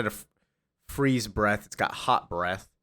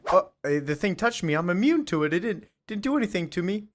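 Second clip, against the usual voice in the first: squeaky Lombard; 9 semitones higher, faster than usual. Retold or imitated. Lombard voiced in retelling.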